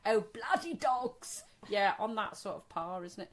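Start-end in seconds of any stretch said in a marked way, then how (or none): 0.1-1.4 mock posh. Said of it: "posh parody".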